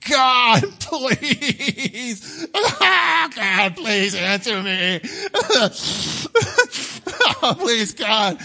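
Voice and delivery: high, wailing fake-sob pleading; mock desperate begging. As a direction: ham up a desperate prayer, voice cracking with laughter. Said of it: "I wasn't earnest enough".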